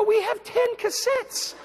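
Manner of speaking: high, drawn-out mock outcry; exasperated high-pitched protest. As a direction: squeal it slowly in disbelief. Exasperated retort to his assistant.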